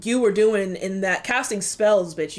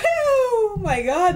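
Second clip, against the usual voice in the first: crying; 12 semitones higher, much slower than usual. Real, not acted. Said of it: "I'm gonna cry again".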